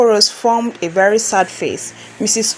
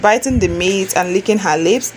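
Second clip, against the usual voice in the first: ordinary delivery, nothing marked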